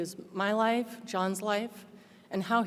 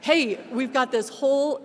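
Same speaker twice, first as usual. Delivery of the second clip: super jolly; 6 semitones higher, 6 dB louder than usual. Retold or imitated. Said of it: "super jolly".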